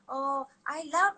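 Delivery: put-on high, drawn-out little-kid voice; excited little niece. Niece reacting to aunt's cooking, excited little-kid voice.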